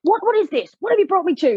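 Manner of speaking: high-pitched mock exasperation; imitating frustrated man. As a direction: act out his indignant, baffled complaint. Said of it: "He's getting so frustrated".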